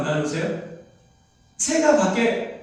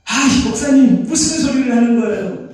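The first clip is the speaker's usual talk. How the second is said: loud, raised, disbelieving protest; incredulous congregant; 5 semitones higher, 7 dB louder than usual. Pretend. Congregation member protesting the pastor, loud and incredulous.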